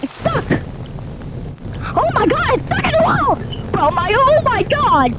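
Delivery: high-pitched panicked yelps; panicked shock. Real, not acted. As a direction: freak out because you're stuck. Stuck in game wall, panics.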